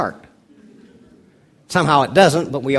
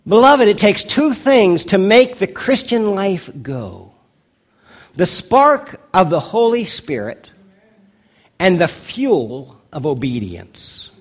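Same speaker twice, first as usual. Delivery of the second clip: louder, lifted preaching voice; 4 semitones higher, 4 dB louder, slower than usual. Acted. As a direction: land the moral with conviction. Pastor drives home the point.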